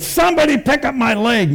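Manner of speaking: pitched high, calling out for help; retold urgent call. Injured man calling to the crowd for his leg, high urgent call. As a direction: cry out for someone to grab it. Stump dragging on the gurney.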